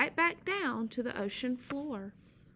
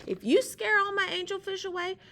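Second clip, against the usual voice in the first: Sea Slug yelling; 4 semitones higher, 8 dB louder than usual. Acted. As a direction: beg him to go, full volume.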